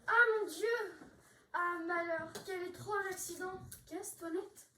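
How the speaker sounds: high, loud exclamation of alarm; mock shock. Maid pretending the master died, loud mock alarm. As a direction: throw up your hands in feigned horror.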